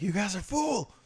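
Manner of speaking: high, loud mock accusation; yelling at American stores, hammed up. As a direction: shout it at the shoppers in fun.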